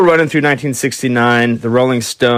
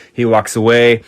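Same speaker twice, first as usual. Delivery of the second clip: usual speaking voice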